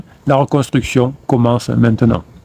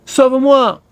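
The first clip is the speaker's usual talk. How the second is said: high, pitched-up plea; mock cry for help; 11 semitones higher, slower than usual. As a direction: play the drifting boat calling for rescue. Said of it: "qui crie".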